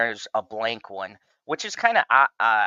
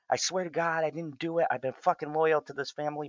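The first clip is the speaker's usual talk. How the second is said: desperate pleading; 6 semitones higher, 4 dB quieter than usual. Retold or imitated. Bodyguard begging for his life.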